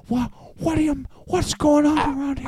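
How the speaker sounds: high-pitched put-on bewilderment; Sebastian Maniscalco. Scolded talker acting clueless, high comic bewilderment. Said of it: "They turn into Sebastian Maniscalco".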